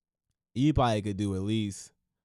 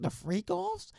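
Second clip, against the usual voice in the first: "freak offs" swooping up high; mock-scandalized lift on "freak offs"; exaggerated emphasis; slower than usual. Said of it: "just put the emphasis".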